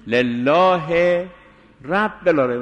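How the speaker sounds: لله drawn out slowly, pause before رب العالمین; slow, separated words. Shown as a demonstration. Teacher models لله rab al-alamin word by word, slowly. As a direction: stretch لله, then a break before رب.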